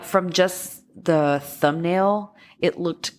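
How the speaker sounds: usual speaking voice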